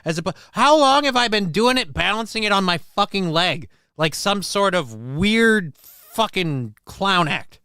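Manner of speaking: ordinary talk, nothing marked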